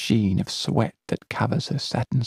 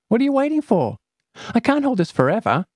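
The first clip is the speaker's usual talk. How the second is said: put-on high, urgent girlish nag; impatient girl; 13 semitones higher, 4 dB louder, much faster than usual. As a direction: hurry her up. Companion straining impatiently.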